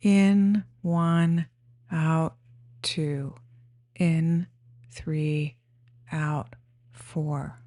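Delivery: single words with a pause after each; breath counting. Shown as a demonstration. Guide showing how to count breaths up to four, breath counting. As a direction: count slowly in time with breathing. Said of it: "counting the breaths up to four".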